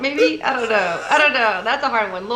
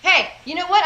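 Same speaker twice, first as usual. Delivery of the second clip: higher-pitched, fed-up parent retelling; mock parental scolding; 8 semitones higher, 3 dB louder than usual. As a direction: pull the kid up short, half playfully. Parent scolding child in retold story.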